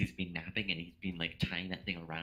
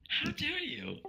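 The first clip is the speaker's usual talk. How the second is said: much higher, squealed theatrical offence; mock outrage; 3 dB louder, slower than usual. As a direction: shriek it in hammed-up indignation. Mock offence, the last time.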